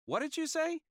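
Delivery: very high, muffled from another room; muffled female voice. Acted. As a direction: call from behind a door. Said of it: "A muffled female voice said".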